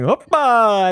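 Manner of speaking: very high, loud Greek-wedding shout; ghost voice. Pretend. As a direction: yell it out like a dancing ghost. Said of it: "why is the ghost going".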